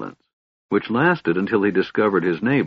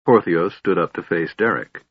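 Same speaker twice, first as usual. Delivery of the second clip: plain reading voice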